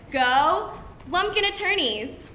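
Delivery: slow hammed-up rally cry; mock cheer.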